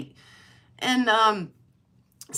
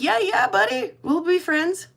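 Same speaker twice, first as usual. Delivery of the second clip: friendly to skunk; 8 semitones higher than usual. Retold or imitated. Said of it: "I'm like".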